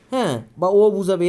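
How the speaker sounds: ordinary talk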